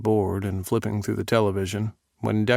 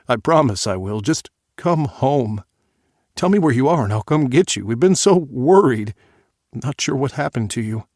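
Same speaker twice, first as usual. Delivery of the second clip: louder, urgent, slowed pleading; desperate plea; 4 semitones higher, 6 dB louder than usual. Acted. Father begging his runaway daughter to come home, loud and urgent. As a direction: beg her to come home, voice rising.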